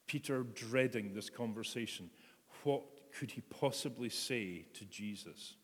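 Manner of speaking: ordinary talk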